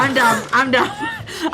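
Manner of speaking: laughing despair. Real, not acted. Player despairs at the roll, laughing.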